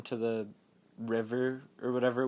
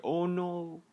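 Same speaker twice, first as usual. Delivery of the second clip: higher, drawn-out "oh no"; mock dismay; 5 semitones higher than usual. Viewer reacting to the boyfriend's violence, mock dismay. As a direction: lament it with hammed-up concern.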